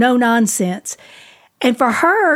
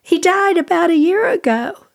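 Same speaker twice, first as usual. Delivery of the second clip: high, distraught retold protest; pitched-up imitation of a crying woman; imitated sobbing; 8 semitones higher than usual. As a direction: act out her tearful denial, easing down as it goes. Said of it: "And she just sobbed".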